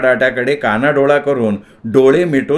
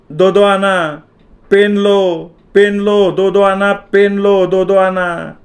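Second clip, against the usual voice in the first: street hawker calling; 6 semitones higher, slower than usual. A narrator's reading. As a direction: call out to the crowd like a pen seller. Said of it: "एक जण मधूनच ओरडत होता".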